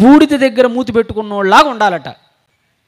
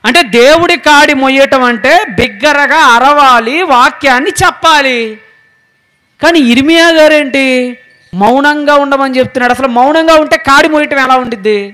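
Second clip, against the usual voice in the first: higher-pitched, insistent preaching; forceful preaching; 5 semitones higher, 5 dB louder than usual. Real, not acted. Preacher insisting God's word must be cried out, forceful preaching. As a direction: press each point on the congregation emphatically.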